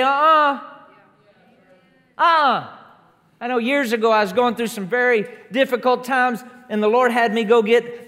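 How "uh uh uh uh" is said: firm refusal. Shown as a demonstration. Preacher showing the right answer to the devil, defiant. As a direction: shut it down flat and bold. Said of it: "rise up on the inside of us and say".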